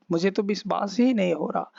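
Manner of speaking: normal talking voice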